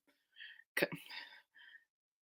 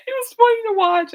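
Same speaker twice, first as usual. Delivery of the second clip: high, helpless laughing voice; 8 dB louder than usual. Real, not acted. Recalling her sister's hot sauce moment, laughing hard. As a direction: squeal it through high, loud laughter.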